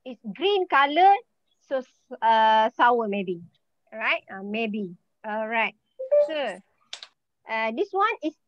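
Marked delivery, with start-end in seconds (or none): none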